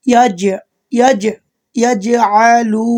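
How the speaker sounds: ইয়াজ with a loud bouncing জ echo; qalqalah demo. Shown as a demonstration. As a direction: bounce the জ in ইয়াজ, then stretch আলু slowly. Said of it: "তাই এখানে বলবেন". (ইয়াজ এ জোরে কলকলা করে বলা)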